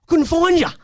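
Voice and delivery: high, loud reenacted telling-off; angry shout. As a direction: yell at the lost kid in a panic. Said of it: "And I was angry at him".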